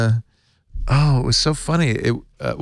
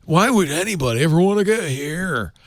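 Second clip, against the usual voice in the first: laid-back stoner, lightly voiced; 6 semitones higher than usual. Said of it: "he had this stoner voice".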